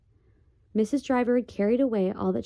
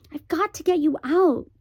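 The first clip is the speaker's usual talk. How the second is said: put-on high, louder urgent boy voice; 9 semitones higher, 7 dB louder than usual. The boy urges the borrowers to flee, high and urgent. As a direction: press them to escape now.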